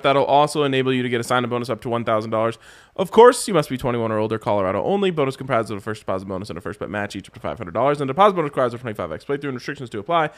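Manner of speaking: rapid-fire terms and conditions; fast legal disclaimer. Acted. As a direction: rattle off the fine print. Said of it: "read-out betting ad terms".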